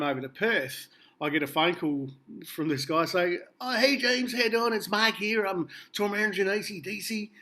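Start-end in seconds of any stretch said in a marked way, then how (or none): 3.6-7.3 imitating a friendly American Southern tour manager; put-on Southern accent. Tour manager inviting James for drinks, in a put-on Southern American accent. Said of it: "I don't know what that accent is".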